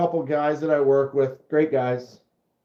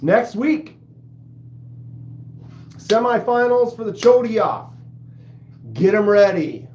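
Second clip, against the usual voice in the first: mock announcer hype; 5 semitones higher than usual.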